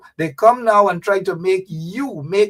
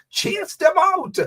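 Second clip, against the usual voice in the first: quoted rallying cry; 12 semitones higher, slower than usual. Retold or imitated. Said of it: "it's Jack Day who said".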